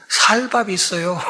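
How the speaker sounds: 쌀밥 starting on a plain s instead of a tense ss; Gyeongsang pronunciation. Retold or imitated. Teasing the Gyeongsang-born instructor at mealtime, Gyeongsang pronunciation. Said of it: "쌍시오 발음 못하죠?".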